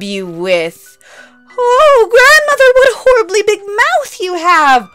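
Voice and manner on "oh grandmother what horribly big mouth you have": high, loud, frightened shriek; little girl crying out in fear; terrified cry. Acted. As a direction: scream it out in terror.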